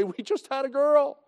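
proud announcement, pitched high; happy dad on the phone; pleased papa. Retold or imitated. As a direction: announce it like a thrilled new father. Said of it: "very pleased papa to call".

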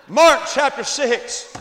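loud, slow, booming shout; preacher's shout. Acted. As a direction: boom the text out to the crowd. Announces the text, repeated.

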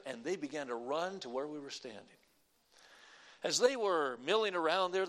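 usual speaking voice

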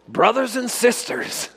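hammed-up old-school preacher greeting; old-time preacher voice. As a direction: intone it like a revival preacher. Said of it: "In that exact voice too".

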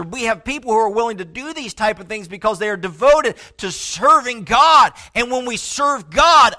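full-force pulpit peak; rising preaching climax. Real, not acted. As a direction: build the line and land hard on "serving God".

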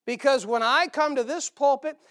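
usual speaking voice

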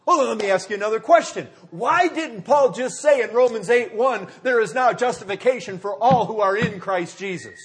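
forceful preaching. Real, not acted. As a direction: press the question on the congregation, loudly.

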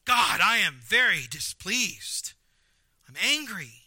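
slow, loud mock indignation at God; acted anger, drawn-out words; displeased outburst. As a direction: spell out your displeasure, slowly and heavily. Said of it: "you're going, Oh".

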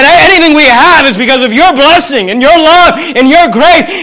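emphatic preaching. Real, not acted. Preaches at full force.

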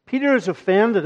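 usual speaking voice

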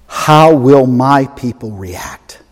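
slow repeated question. Shown as a demonstration. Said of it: "The real test on this planet is".

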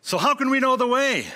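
high, loud, blurted question; blunt Thomas. Retold or imitated. Preacher voicing Thomas blurting his question to Jesus, high and loud. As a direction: let the question burst out openly. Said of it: "And he says".

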